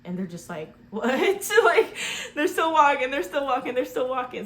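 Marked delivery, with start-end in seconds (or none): 0.9-1.5 high, loud incredulous cry; mock disbelief. Acted disbelief of the walkers. 1.6-4.5 high, loud, through real laughter; laughing disbelief. Real, not acted.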